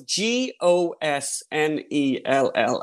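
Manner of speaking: slow, letter by letter; spelling it out. Retold or imitated. Dealer spelling the name for the detective, slowly.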